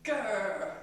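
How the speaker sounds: loud playful growl; hammed-up angry growl; mock angry growl. Shown as a demonstration. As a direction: growl to terrify the class. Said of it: "terrify me with your angry gaze".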